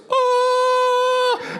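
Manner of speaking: very high, slow, drawn-out gasp of wonder; opening the box with the first watermelon helmet, delighted surprise. Real, not acted. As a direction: let the oh float up in amazement, laughing.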